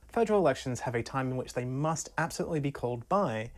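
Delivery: normal talking voice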